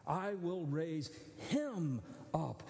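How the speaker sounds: slow, "him" drawn out and weighted; stress on him. Shown as a demonstration. Preacher to his congregation, slow emphasis on "him". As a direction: linger on "him" in "raise him up". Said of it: "Because it's singular".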